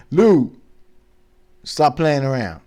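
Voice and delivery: loud scolding call; yelling at Lou to stop messing around; sharp call out. Real, not acted. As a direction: snap at Lou to quit it. Scolding someone off camera.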